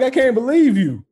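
high, loud wounded protest; mock indignation. Son scolding mom for embarrassing him, loudly indignant. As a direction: protest loudly in comic disbelief. Retold exasperation with mother's teasing.

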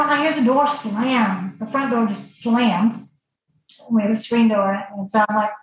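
normal talking voice

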